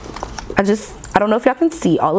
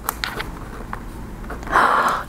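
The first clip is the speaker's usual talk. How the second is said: soft breathless gasp of delight; quiet gasp of awe; hushed gasp; 7 dB quieter than usual. Real, not acted. Pen tester to viewers, soft gasp.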